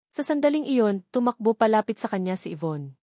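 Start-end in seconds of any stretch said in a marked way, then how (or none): none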